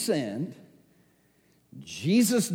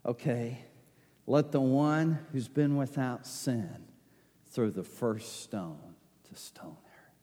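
soft Jesus; 4 semitones lower, 7 dB quieter than usual. Retold or imitated. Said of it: "And Jesus says".

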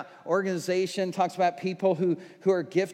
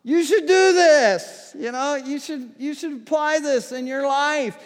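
raised, eager voice, pitched up and louder; excited preaching demo; 6 semitones higher, 6 dB louder than usual. Shown as a demonstration. Pastor showing how he talks on stage, excited and raised. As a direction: sell the Bible like the best news ever. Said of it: "I talk like that".

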